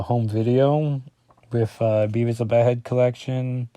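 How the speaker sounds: ordinary talk, nothing marked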